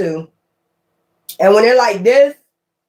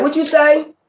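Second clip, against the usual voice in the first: higher, sharp challenging question; indignant what did you say; 7 semitones higher than usual. Retold or imitated. Confronting someone who muttered at you, high indignant challenge. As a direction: demand it back like you half-heard an insult. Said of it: "You like".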